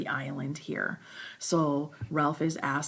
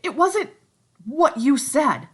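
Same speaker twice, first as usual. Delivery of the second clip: excited Piggy; 8 semitones higher, 10 dB louder than usual. Acted. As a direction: search for the formula.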